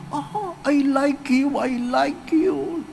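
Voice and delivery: slow, put-on foreign accent saying the line; imitating a foreigner's English, slowly and lightly. Priest quotes a foreigner. (mabagal na ginagayang puntong dayuhan)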